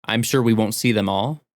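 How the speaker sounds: ordinary talk, nothing marked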